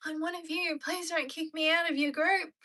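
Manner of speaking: high, mock-desperate begging; desperate plea. Acting out someone desperate to fit in, high mock plea. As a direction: beg to be let in, hammed-up. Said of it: "desperation to be like".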